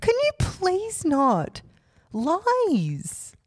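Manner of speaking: high, drawn-out pleading protest; mock indignation. Podcaster denying co-host's farting accusation, mock indignant. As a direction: stretch it out high, playfully scandalized.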